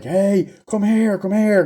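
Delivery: high-pitched mock hail to a boat; acted high call for help. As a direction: imitate calling someone over from afar. Characters calling to passing boat.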